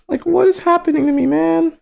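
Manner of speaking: very high squeaky plea; bewildered falsetto. Pretend. Straight speechless at his own reaction, bewildered falsetto. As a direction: wail it lightly in falsetto.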